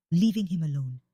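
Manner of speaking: ordinary delivery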